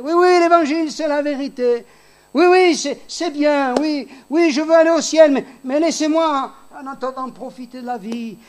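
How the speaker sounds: high, breezy put-off voice; mocking careless sinner. Preacher mimicking people who delay conversion, high and flippant. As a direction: brush off salvation lightly, mocking. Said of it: "des gens, ils sont comme ça".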